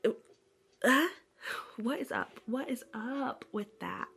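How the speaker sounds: ordinary talk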